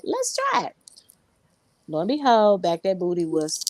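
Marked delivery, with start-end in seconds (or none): 0.0-0.8 eager. Retold or imitated. Deciding to try Southern soul.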